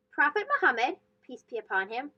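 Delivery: ordinary delivery, nothing marked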